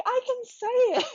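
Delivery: very high, loud squeal of triumph; elated, high-pitched retold exclamation; triumphant cry. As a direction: burst out with joyful relief at a breakthrough. Exultant retold video message to her coach.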